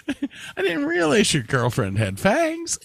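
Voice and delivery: high squeaky hammed-up voice, laughing; mock innocent gossip. Continues mock killer joke.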